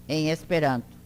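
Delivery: ordinary talk, nothing marked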